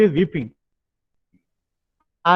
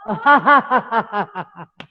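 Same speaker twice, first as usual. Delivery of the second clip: higher, open demo laugh; big raised-arms laughter; laugh with hands raised; 4 semitones higher than usual. Shown as a demonstration. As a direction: throw your arms up and laugh. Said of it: "Now raise your hands, laugh".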